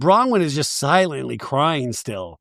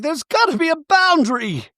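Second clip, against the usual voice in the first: much higher, fragile put-on voice; Bronwyn voice; 12 semitones higher than usual. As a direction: play her as close to tears. Bronwyn pleading.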